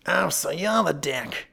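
slow, growling tough man. A narrator's reading. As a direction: growl it like a thick-set superintendent. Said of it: "growled the thick-set man".

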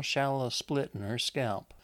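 ordinary delivery

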